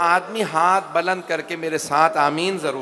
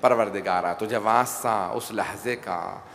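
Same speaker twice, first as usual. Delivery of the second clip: softer, lower pleading supplication; prayer; 5 semitones lower, 6 dB quieter than usual. Acted. Preacher leading the gathering in supplication, soft pleading. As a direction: beseech God quietly line by line.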